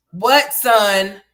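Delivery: called out loud across the house; calling out. Real, not acted. Mother calling out to her son who wants something.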